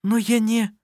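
high-pitched hesitant protest; objecting. A narrator's reading. Anna-Maria objecting to the offered wine, high protest. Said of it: "возразила она".

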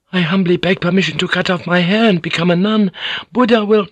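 high, rising humble plea; high-pitched feminine entreaty; desperate begging. Acted. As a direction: beg in a high voice until cut off. Woman begging, gets cut off.

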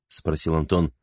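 ordinary delivery, nothing marked

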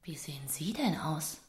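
hushed, appalled whisper; shocked whisper. Acted. Publisher gasps at the poet's appearance, whispering. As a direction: breathe it out in dismay.

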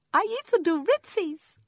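put-on very high, loud, slow child voice; proud toddler. A narrator's reading. Toddler boasts to her mommy in the bath, proud child voice. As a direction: announce it like a little girl showing off.

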